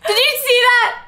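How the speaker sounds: frantic alarm; panicked mock shout. Edward yelling to Alice, panicked mock shout. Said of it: "Edward's like".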